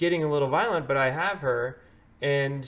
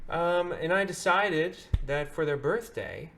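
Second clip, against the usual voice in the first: ordinary delivery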